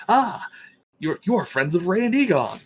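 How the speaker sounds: high, loud, lit-up exclamation; light up as if greeting welcome guests; delighted recognition. Acted. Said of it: "face just lights up at this".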